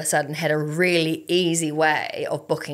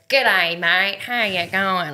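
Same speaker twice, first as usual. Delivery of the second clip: put-on slow, broad Australian accent greeting; 2 semitones higher, much slower than usual. Australian guy greets a Kiwi woman, slow broad Australian accent. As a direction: lay on the Australian accent, drawn out. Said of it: "the Australian accent".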